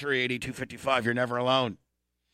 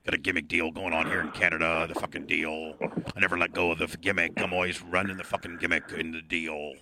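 low wrestler-style drone; gimmick heavy breather; 8 semitones lower, 3 dB quieter than usual. Pretend. Gimmick caller explaining his act, low heavy-breather voice. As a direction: drop low and breathe heavily through the bit. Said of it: "I'm a heavy breather".

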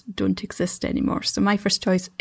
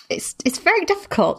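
stage fright; 10 semitones higher than usual. Real, not acted. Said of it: "I'm so nervous right now".

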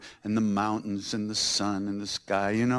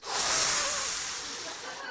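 blissful sigh; 5 dB quieter than usual. Retold or imitated.

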